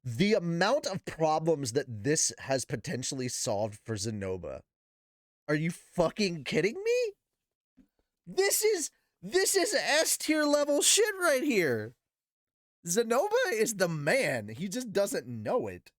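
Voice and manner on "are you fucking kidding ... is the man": pitched way up, thrilled outburst; hyped up. Real, not acted. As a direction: gush like a fan losing it. Hyped reaction to the story.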